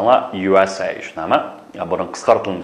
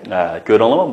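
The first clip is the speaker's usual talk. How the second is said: ordinary talk, nothing marked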